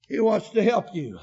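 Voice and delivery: normal talking voice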